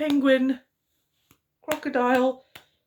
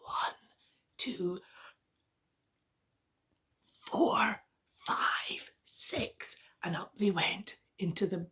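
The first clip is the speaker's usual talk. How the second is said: whispering; 6 dB quieter than usual. A narrator's reading. Storyteller whispers as the bears creep upstairs. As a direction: tiptoe whisper up the stairs.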